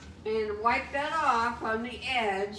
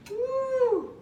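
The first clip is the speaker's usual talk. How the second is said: high whooping cheer; happy high woo; excited whoop; 13 semitones higher than usual. Real, not acted. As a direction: cheer before the first bite. About to taste the dish.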